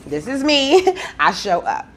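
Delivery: high, rising "This is me", then a laugh; sing-out declaration breaking into a chuckle; playful proclamation. Acted. Proclaims the card's declaration as herself.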